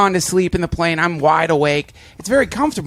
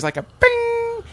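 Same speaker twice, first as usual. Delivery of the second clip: imitated chime; slower than usual.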